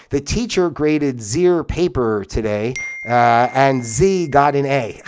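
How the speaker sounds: careful slow read of the sample line; slow, deliberate usage demo; slow example sentences. Shown as a demonstration. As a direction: stretch it out like a worked example. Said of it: "H I R".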